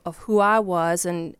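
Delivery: normal talking voice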